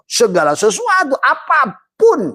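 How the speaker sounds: very high, slow, loud climax; preaching cry. Acted. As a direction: stretch it out as the sermon's peak.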